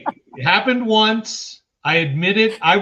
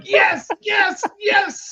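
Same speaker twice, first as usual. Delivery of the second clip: thrilled yelled yes yes yes; overjoyed parents; much slower than usual. Retold or imitated. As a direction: shout for joy like parents jumping up. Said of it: "falling out of their seats going".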